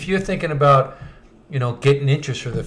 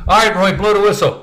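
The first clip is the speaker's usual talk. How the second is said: coach calling out; 8 semitones higher, 7 dB louder than usual. Retold or imitated. Coach voiced telling Roy.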